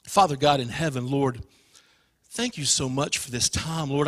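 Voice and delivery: low, soft, hushed prayer. Real, not acted. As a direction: lead a congregation quietly into prayer. Leads a prayer.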